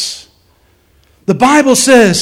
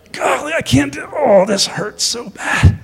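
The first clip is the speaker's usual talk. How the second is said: mock pained whine; 4 semitones higher, 3 dB quieter than usual. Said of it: "Paul's over here like this".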